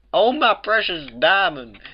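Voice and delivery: high, loud, drawn-out gush of devotion; melodramatic exclamation. A narrator's reading. Applejack bursts in on her love, melodramatic exclamation. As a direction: exclaim it slowly, swooning with love. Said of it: "Applejack exclaimed".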